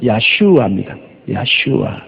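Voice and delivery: YASHUA said as Hebrew, ya-shu-a; Hebrew pronunciation. Shown as a demonstration. Preacher giving congregation the Hebrew name, 야슈아 pronounced carefully. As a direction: sound out 야슈아 syllable by syllable. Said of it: "히브리 원어는 야슈아".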